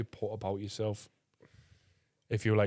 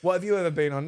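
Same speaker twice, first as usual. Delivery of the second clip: louder, raised challenge; mock angry; 5 semitones higher, 5 dB louder than usual. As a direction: imitate a scornful comeback. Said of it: "Like, he's angry".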